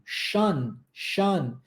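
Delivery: "Shun, shun" with a soft sh and short vowel; shun sound demo. Shown as a demonstration. Teacher showing TION as "shun". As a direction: say "shun" twice, cleanly, as the TION ending. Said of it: "T I O N em inglês possui um padrão linguístico".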